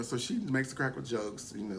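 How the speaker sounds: ordinary delivery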